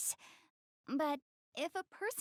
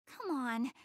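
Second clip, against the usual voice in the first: normal talking voice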